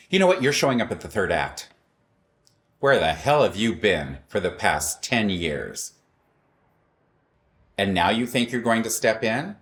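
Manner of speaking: rising to a loud, indignant telling-off; angry outburst. Retold or imitated. Narrator tells off her partner's sister, loudly indignant. Confronting the sister over Jim.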